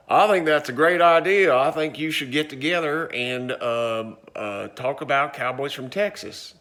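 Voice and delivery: hammed-up Texas cowboy Slim; second cowboy Slim. Shown as a demonstration. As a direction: play the second cowboy answering again. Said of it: "I've lost my Texas accent".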